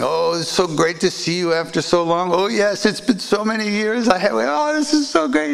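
joyful reunion. Retold or imitated. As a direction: gush with delight at an old friend. Old friends reunited, hugging.

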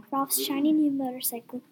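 ordinary delivery, nothing marked

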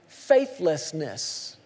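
ordinary talk

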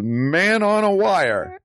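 high, drawn-out mock dad call; hammed-up dad voice, high and slow; imitated dad. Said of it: "my dad".

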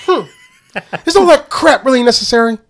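high, loud comic chef impression; hammed-up, laughing, high-pitched chef shouting; movie hibachi chef. Quoting the chef from Police Academy, hammed-up impression. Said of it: "hibachi chef in, uh, Police Academy".

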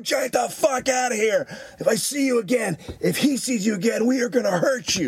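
high-pitched shouted threat, re-enacted; threatening mock shout, pitched high; retold yelling. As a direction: re-enact chasing someone off. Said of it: "and just yelling".

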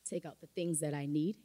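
ordinary talk, nothing marked

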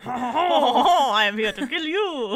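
a hint of a mock French accent; lightly hammed-up French accent threat. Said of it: "do a French accent for little Philippe".

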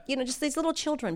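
usual speaking voice

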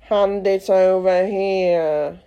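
slow, pompous demand; dodo voice. A narrator's reading. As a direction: hold out a hand grandly. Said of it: "said the dodo".

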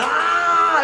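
long high-pitched wail; drawn-out "aah" of a screaming crowd; imitated scream. Egyptians crying out at the midnight plague, screaming. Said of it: "empezaron la gente a gritar".

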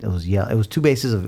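ordinary talk

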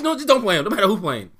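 ordinary talk, nothing marked

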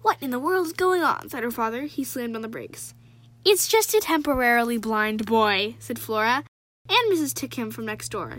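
put-on lively read-aloud of a children's novel; story reading. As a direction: read the chapter to your viewers with energy. Reads book excerpt aloud.

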